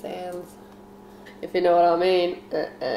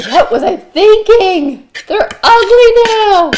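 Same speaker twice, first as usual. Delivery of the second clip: much higher, louder slow cry of disgust; horrified; 12 semitones higher, 13 dB louder, slower than usual. Retold or imitated. Grown up looking back at old crush, loud horrified. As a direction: shriek it with a laugh. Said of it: "you're like".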